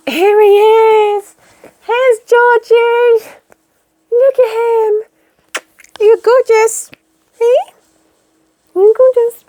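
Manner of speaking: high, slow cooing pet voice. Real, not acted.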